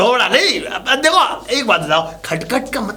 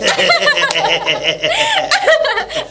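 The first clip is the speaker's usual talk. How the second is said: long high giggle trailing down; high laugh; 8 semitones higher than usual. Acted. He giggles high and long over khatkhat.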